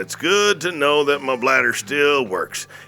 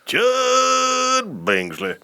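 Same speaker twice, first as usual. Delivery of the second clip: drawn-out character voice; 12 semitones higher, much slower than usual. Acted. Announces character name stretched.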